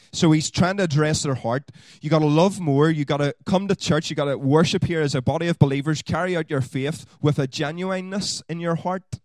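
loud, high insistent preaching; preaching at full force. Acted. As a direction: press the congregation hard. Preaching, urging the congregation.

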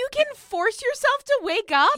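high, loud disbelief; incredulous. Real, not acted. Co-host stunned that her friend can wake herself at will, incredulous. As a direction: pitch shoots up in real astonishment.

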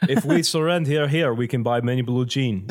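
hammy fake Russian accent; a clumsy put-on Russian accent; bad Russian accent. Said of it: "doing bad Russian accent".

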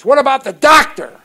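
loud, high challenge from the sponsor; pushy sponsor. Retold or imitated. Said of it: "And my sponsor says".

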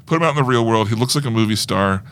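usual speaking voice

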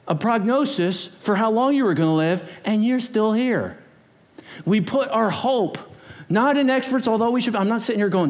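normal talking voice

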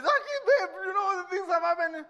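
put-on upset girlfriend. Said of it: "she calls you and says".

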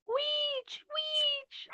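very high-pitched mock yell; imitated yell. Co-host mimics the woman who spots witches, high mock yell. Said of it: "the woman yells".

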